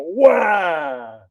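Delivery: wailing shout. Acted. Bartender loses his best customer, wails in a hammed-up shout. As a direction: wail it out like a hammed-up sob.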